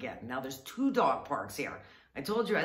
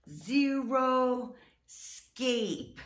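zero scape stretched very slow and high; over-enunciate zero scape, hugely slow; exaggerated slow explaining; 8 semitones higher, much slower than usual. Shown as a demonstration. Explaining the garden name to someone without English. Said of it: "I'm trying to explain".

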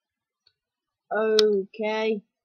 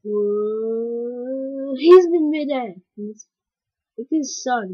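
ordinary talk

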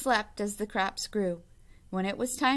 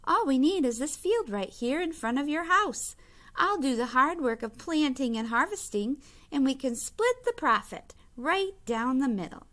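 eager Hare; 6 semitones higher, 4 dB louder than usual. A narrator's reading. Hare sweet-talking sleepy Bear.

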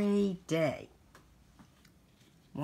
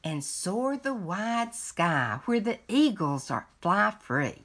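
lively picture-book read-aloud; reading to children. Acted. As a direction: read it like a bedtime story to a grandchild.

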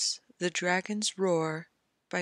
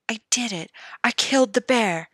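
breathless start swelling into a loud, higher boast; excited cry; 5 semitones higher, 5 dB louder than usual. A narrator's reading. Joffrey boasting about killing the bear, excited cry. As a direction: crow over the kill like a proud boy. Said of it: "Joffrey cried out".

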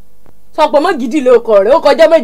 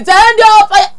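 higher, wailing prayer-warrior cry; mock fire prayer; 9 semitones higher, much slower than usual. Teasing her friend, a crying mock prayer for fire. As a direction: plead to heaven for fire, half-crying.

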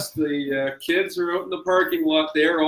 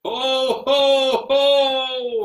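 booming high jolly ho ho ho; belly-laugh greeting as Santa; 10 semitones higher, 4 dB louder, much slower than usual. Acted.

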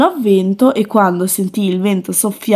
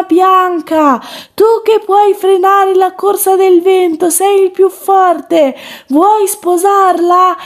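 shouted up; 10 semitones higher than usual. Acted. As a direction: call it up to the top of the tower. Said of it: "e gridò".

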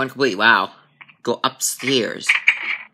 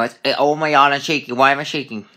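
normal talking voice

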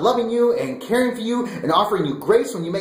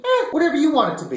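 mocking; 7 semitones higher, faster than usual. Mocking the silly test answer.

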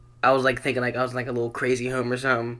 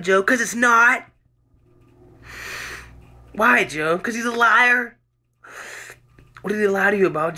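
loud, higher two-voice dramatic reading of a doctor grilling Joe; acted doctor and patient; 8 semitones higher, 7 dB louder than usual. Reading his jail piece aloud, doctor questioning Joe, loud and higher. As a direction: play both doctor and troubled Joe, loud and raised.

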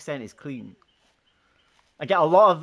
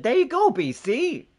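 high, drawn-out cheer; celebrating a teammate; excited cheer; 9 semitones higher, 5 dB louder than usual. Real, not acted. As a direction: call it out gleefully, higher and slower.

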